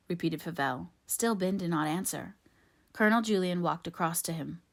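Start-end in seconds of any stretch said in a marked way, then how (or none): none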